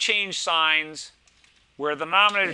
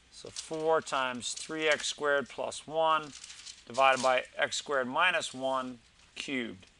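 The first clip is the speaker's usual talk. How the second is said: slow, spelled-out reading for copying; slow dictation; 4 dB quieter, slower than usual. Shown as a demonstration. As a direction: read it slowly so students can write. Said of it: "Let's copy that down".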